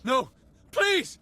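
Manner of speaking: a terrified scream for mercy, pitched very high; desperate plea. Acted. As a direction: beg for your life as the guards close in. Agent begging before execution.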